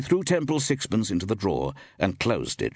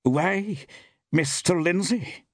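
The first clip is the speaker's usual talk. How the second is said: high, drawn-out surprised exclamation; some surprise; 10 semitones higher, slower than usual. A narrator's reading. Woman shopkeeper greets a sudden visitor, in some surprise. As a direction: exclaim it high, taken aback. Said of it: "exclaimed the woman in some surprise".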